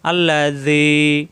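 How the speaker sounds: slow chanted tilawat with long drawn vowels; Quran recitation. Shown as a demonstration. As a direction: recite slowly, stretching each vowel. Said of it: "একালিপ টান".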